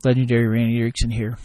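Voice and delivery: loud, fast sponsor read intro. Acted. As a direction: announce yourself like a radio spot.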